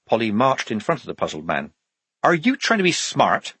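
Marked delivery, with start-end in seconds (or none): 2.2-3.6 high-pitched, challenging question; demanding. A narrator's reading. Polly confronting the puzzled guard inches from his face, demanding. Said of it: "she demanded".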